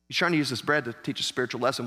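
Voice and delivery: normal talking voice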